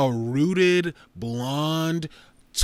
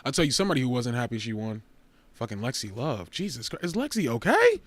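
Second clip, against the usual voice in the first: ordinary talk, nothing marked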